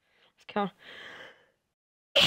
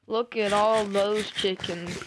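slow, louder, drawn-out meme line; slow, louder meme delivery; meme quote; 7 dB louder, much slower than usual. Pretend. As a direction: stretch it out like the famous clip. Quoting a meme at chickens.

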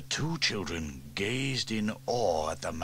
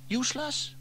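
put-on high, bright-eyed girl wondering aloud; young girl Sarah, curious. A narrator's reading. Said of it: "asked Sarah".

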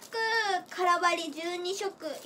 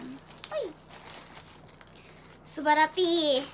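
usual speaking voice

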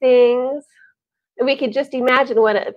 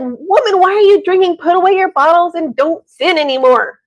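higher and louder, a mock scolding priest; accusing, hammed-up priest; stern priest's rebuke; 6 semitones higher, 6 dB louder than usual. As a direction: scold her as if she were drunk. Priest scolds a supposedly drunk woman.

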